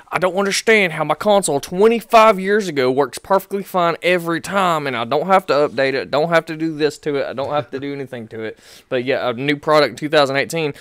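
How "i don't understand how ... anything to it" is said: put-on grumpy old man rant. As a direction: rant like a cranky oldster. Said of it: "I'm like".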